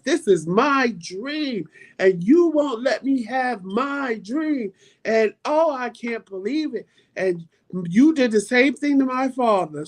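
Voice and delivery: hammed-up teenage tantrum at mom; teen pleading outburst. Fifteen-year-old in the car begging mother, high slow wailing. I gave her the speech of life.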